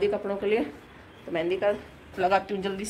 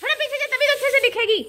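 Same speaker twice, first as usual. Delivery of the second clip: calling directions; 5 dB louder than usual. Real, not acted. Directing child for camera.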